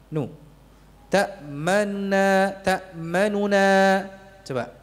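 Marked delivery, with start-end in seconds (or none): 1.1-3.7 Ta'manuna drawn out slowly, nun clear; slow demo. Shown as a demonstration. Teacher modelling Ta'manuna for students to copy. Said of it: "tapi apa? Nu".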